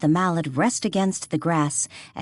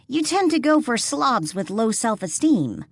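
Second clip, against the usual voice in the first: teasing; 7 semitones higher than usual. A narrator's reading. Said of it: "Lily teased".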